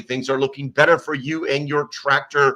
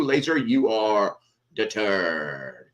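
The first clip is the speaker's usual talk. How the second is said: slow, stretched-out announcer call; drawn-out announcer; much slower than usual. Acted. Host crowning Bradley third in chat, slow mock-announcer drawl. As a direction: drag each word like a game-show host.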